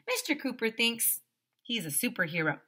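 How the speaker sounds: usual speaking voice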